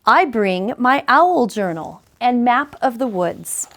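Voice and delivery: plain reading voice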